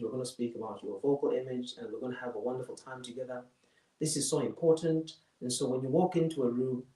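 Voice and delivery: low, soft, unvarying monotone. Shown as a demonstration. Host welcoming a masterclass audience, in a dull monotone. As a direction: drone on one low note. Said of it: "this is how I was speaking".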